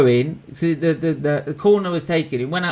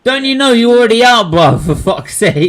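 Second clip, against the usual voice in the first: loud, high exasperated complaint; exasperated outburst; 7 semitones higher, 7 dB louder than usual. Real, not acted. Fan scolding Canada's keeper for coming up, loud exasperation. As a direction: call out at the screen, exasperated.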